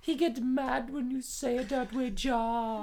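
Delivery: very high, stammering fan character; mock fan voice. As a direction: squeak it out as a starstruck fan.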